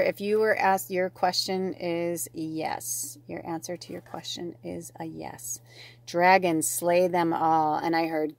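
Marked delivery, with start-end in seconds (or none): 3.3-5.6 hushed, unvoiced whisper; whispered answer. Real, not acted. Reader giving the viewer their answer, whispered. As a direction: whisper it like a confidence.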